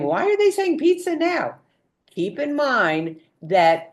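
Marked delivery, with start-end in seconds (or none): 0.0-1.6 higher, quick baffled question; puzzled mock question. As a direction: sound caught off guard. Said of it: "And you're saying".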